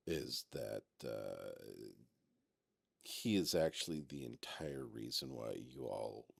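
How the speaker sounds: very soft, low, halting voice; subdued in-character admission, low and soft; hesitant, confiding character. Acted. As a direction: confide it quietly, pausing to find the words. Game master voices a non-player character.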